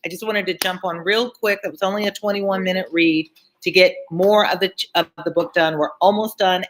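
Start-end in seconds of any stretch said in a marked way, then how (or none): none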